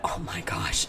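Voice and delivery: hushed whispered aside; conspiratorial whisper. Real, not acted. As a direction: drop to a whisper as if she could hear. Secret aside about professor.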